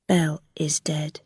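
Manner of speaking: low, hushed confidence; very quiet. A narrator's reading. Guard secretly telling Rilla the news, hushed. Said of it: "very quietly".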